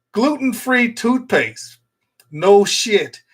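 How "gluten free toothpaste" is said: higher and slowed, drawn out in disbelief; mock disbelief. Real, not acted. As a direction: spell it out like it's absurd. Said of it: "mock disbelief at product".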